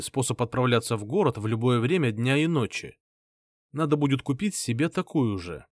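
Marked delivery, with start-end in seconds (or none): none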